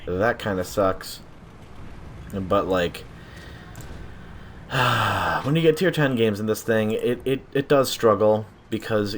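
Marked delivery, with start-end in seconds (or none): 3.4-5.4 long breathy sigh falling away; weary sigh. Real, not acted. Gamer about to admit the tank struggles, long sigh. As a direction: let out a drawn-out sigh. Sighs before tank's weakness.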